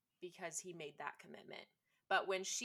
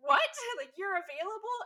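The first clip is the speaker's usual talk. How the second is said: high, loud, overjoyed shock; imitating a heroine hearing he's free, in loud high disbelief; 12 semitones higher, 13 dB louder than usual. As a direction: squeal it in stunned relief. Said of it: "She's like".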